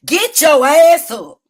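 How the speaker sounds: normal talking voice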